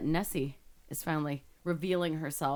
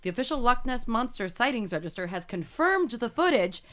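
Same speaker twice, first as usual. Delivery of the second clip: emphatic, dramatic article reading; news read; 6 semitones higher, 4 dB louder than usual. Acted. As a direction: build intrigue as you read the report. Reads the news story aloud.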